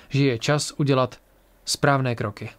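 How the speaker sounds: ordinary delivery, nothing marked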